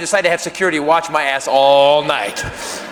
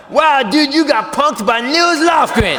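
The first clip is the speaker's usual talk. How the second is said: high, loud jeer; raised, jeering dude voice; mocking exclamation; 7 semitones higher, 4 dB louder, slower than usual. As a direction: taunt loudly, dragging out the words. Mocking the disappointment, acted.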